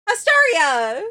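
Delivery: loud, high-pitched scolding yell; shouted squeal of embarrassment; mock-outraged shout. Real, not acted. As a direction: shriek it in playful protest. Mock-scolds viewer before newcomers.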